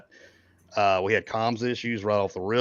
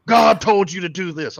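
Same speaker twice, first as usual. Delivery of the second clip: loud, higher mock yell of a commander; retold scream; 9 semitones higher, 8 dB louder than usual. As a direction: bellow the order like a drill sergeant. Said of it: "he starts screaming at him, bloody murder".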